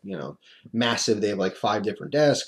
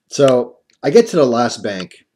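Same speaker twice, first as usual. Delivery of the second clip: ordinary talk, nothing marked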